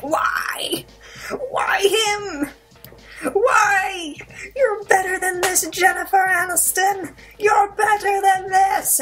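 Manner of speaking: high, loud, drawn-out wail; mock anguish. Viewer begging Jennifer Aniston to do better, mock anguished wail. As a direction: plead at the top of your voice, hammed-up.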